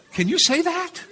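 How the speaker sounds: high, incredulous squeak with a laugh; shocked question. Retold or imitated. Young student shocked by the insult, high and laughing. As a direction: gasp it out in laughing disbelief.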